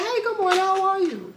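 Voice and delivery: high-pitched, chirpy mock hello; cheerful greeting. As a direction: sing out a friendly morning hello. Acting out a cheerful morning greeting.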